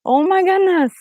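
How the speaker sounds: retelling how the message made her cry; overwhelmed near tears. Retold or imitated. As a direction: sound moved to the point of tears. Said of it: "And I just started crying".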